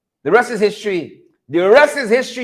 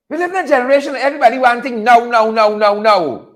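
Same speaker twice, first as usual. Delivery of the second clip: normal talking voice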